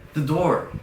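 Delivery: high, loud burst of alarm; retold urgent shout; alarmed exclamation. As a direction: cry it out in sudden realization. Acts out sudden alarm.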